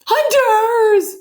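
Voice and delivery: very high, loud cry of alarm; panicked yell. Acted. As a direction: scream it like a scared moose. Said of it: "and yelled".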